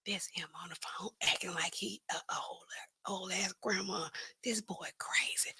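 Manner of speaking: hushed, breathless whisper; whispered aside. Retold or imitated. Police whispering about the caller, hushed and amused. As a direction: whisper it behind his back. Police mocking him quietly.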